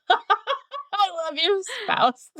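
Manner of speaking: drawn-out laughing words. Real, not acted. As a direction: laugh through it, slowly. Laughing at spouse's joke.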